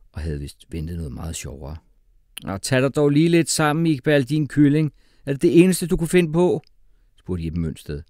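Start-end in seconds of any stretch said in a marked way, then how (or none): 2.4-6.6 loud, high, contemptuous teacher; scolding teacher. A narrator's reading. Teacher scorning pupil's feeble joke, loud and contemptuous.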